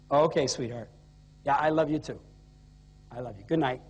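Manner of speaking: low, subdued phone voice to a wife; husband's routine call. Retold or imitated. Husband calls his wife from the hotel, low and matter-of-fact. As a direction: keep it low and matter-of-fact, just checking in. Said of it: "The call went like this".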